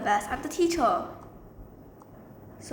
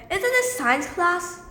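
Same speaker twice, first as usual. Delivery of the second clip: higher, slow, pointed question; acted student challenge; 6 semitones higher, 4 dB louder than usual. Paid actor student challenges the teacher, acted student challenge. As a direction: challenge the teacher in a raised pitch.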